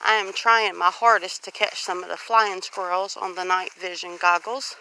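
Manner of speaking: normal talking voice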